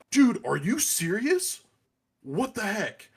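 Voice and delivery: high, loud, outraged yell; angry kid yelling. Retold or imitated. Kid in the back yelling across the store, outraged. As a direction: shout it like a kid who's been wronged.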